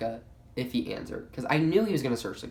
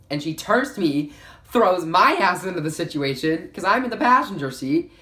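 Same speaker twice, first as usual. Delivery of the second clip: louder and higher, worked up over being dragged in; rising outrage, pitched up and loud; indignant; 6 semitones higher, 7 dB louder than usual. Real, not acted. Telling viewers how the friend dragged her in, worked up and loud.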